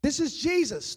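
usual speaking voice

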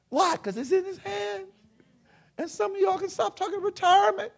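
high, loud, impassioned sermon shout; full-force preaching. Real, not acted. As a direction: preach it at the top of your register.

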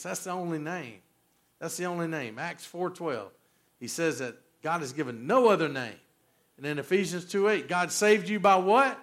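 ordinary talk

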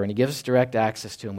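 ordinary delivery

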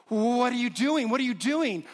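high, loud alarmed repeat; panicked wife. Retold or imitated. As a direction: cry out in alarm at him.

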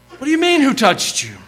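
high-pitched disbelief, dropping at the end; incredulous. Acted. Disciple busy hurrying Jesus along, incredulous. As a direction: throw the question back, baffled.

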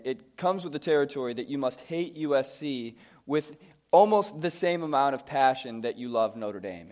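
ordinary talk

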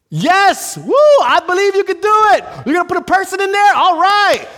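very high, loud, fast enthusiasm; eager cheering. Acted. Spectator cheers on wheelbarrow stunt, wildly eager. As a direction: shout like an overexcited fan.

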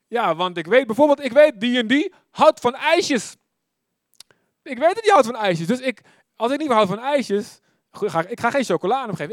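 lively preaching. Retold or imitated. Preaching excitedly to youth.

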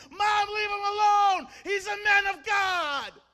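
long, high desperate scream; a boy screaming to stop his mother; screaming and shouting. Acted. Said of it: "screams and shouts".

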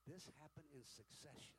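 ordinary talk

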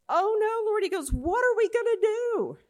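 high, loud panicked cry. Acted. Servant to Elisha on seeing chariots, panicked cry. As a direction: cry out in terror at the army. Servant sees army surrounding them.

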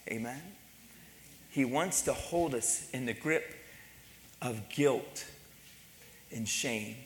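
low, soft and weighty sermon voice; grave, hushed preaching. Real, not acted. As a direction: let it sink in with long pauses. Preacher drops to grave hush.

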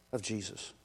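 ordinary delivery

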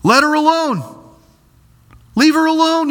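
high, firm "Let her alone" as Jesus. Acted. Preacher voicing Jesus defending Mary. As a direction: lift the voice high and command "Leave her alone". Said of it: "what's he say".

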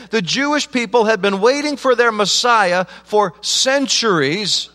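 impassioned pulpit preaching, high and loud; a pastor pleading with his Palm Sunday congregation, fervent full-voiced preaching; full-force preaching. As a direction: drive it home like a revival preacher.

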